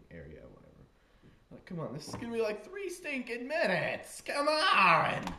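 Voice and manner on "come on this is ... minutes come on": mock-exasperated shout, rising high; impatient yell. Impatient riders stuck waiting, building to an exasperated yell. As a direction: build from complaint to a loud, high wail.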